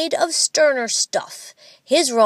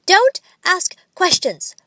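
put-on higher and louder, a child snapping like a parent; curt order; 9 semitones higher, 6 dB louder than usual. A narrator's reading. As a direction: shut the question down. Boy bosses his papa.

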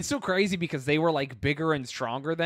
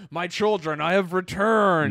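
God coming down; 3 dB louder than usual. Acted. God greets his children on coming down, grandly proclaiming. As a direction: announce your return like a solemn deity. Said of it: "Imagine God coming down".

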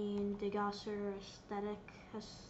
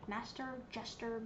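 ordinary talk

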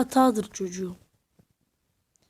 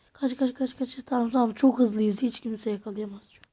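ordinary talk

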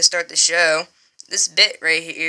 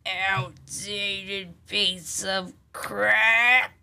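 very slow voice; 6 dB quieter, much slower than usual. Acted. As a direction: stretch it out very slowly. Slow distorted repeat of insult.

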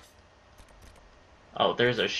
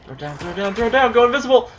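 frantic; 9 semitones higher, 7 dB louder, faster than usual. Real, not acted. Frantic commands while fleeing.

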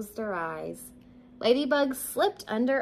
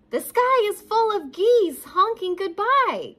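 high, loud, urgent news; crying out; 11 semitones higher, 8 dB louder than usual. A narrator's reading. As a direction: cry it out high to a sleepy listener. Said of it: "cried Ladybug".

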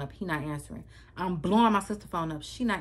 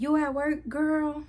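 high, louder-edged imitation of mom's odd greeting; talking weird; 10 semitones higher, 4 dB louder, slower than usual. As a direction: imitate mom acting oddly, pitched way up. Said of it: "she called me talking hella weird".

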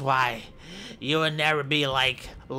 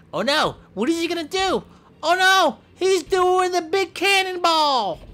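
much higher, louder alarm; excited announcer; 5 dB louder than usual. Pretend. Commentator shrieking as the wrestler goes for a cannonball.

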